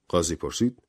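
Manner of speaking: plain reading voice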